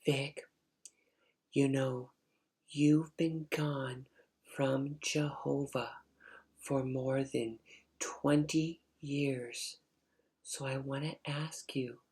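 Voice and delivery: hushed, slow, careful mom voice; gently pleading mother. Retold or imitated. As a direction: break it to your child very softly. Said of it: "she was talking just like that".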